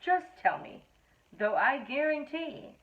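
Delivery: ordinary delivery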